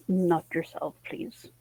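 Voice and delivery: ordinary delivery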